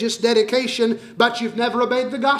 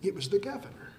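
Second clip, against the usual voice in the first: broken murmur; 2 semitones lower, 11 dB quieter than usual. Acted. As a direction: mutter it to yourself on the gallows. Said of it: "he was still saying".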